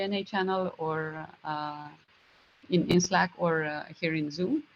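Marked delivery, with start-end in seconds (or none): none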